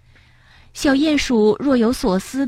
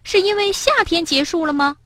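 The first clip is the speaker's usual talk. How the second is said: put-on higher, curious young frog voice; higher pitched friend asking why; little friend Bengbeng; 9 semitones higher than usual. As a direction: ask gently as a little animal friend.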